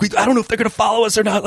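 fast, high worried babble; nervous driver. Retold or imitated. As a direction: rattle it off like the scared driver. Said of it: "He's like".

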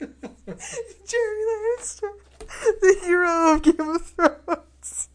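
hammed-up hero tribute on the edge of laughter; mock epic announcer. Co-host hailing the hero of Game of Thrones, mock epic. Parody trailer for invented hero.

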